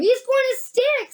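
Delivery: put-on high boy voice; young boy. A narrator's reading. Charlie predicting the boy will stick, high-pitched. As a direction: pitch it up like a child.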